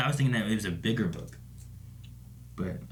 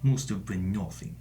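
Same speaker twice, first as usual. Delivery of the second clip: low, flat soldier voice; bored patrol guard dismissing a noise; video game guard; 3 semitones lower than usual. Retold or imitated. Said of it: "the Metal Gear Solid guards".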